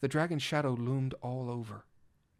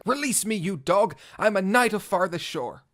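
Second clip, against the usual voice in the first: loud, higher furious yell; angry yell; 5 semitones higher, 6 dB louder than usual. Acted. Captured cat-knight yelling at policemen, loud defiance. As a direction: yell defiantly at your captors. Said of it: "growled and hissed and yelled".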